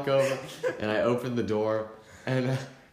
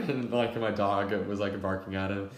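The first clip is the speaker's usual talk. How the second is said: ordinary talk, nothing marked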